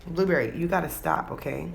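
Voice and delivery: usual speaking voice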